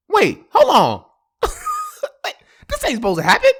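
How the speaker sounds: loud panicked disbelief; shocked disbelief. Retold or imitated. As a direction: blurt it out in shock. Shock at news.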